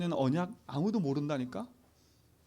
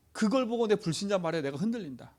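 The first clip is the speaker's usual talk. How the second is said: ordinary talk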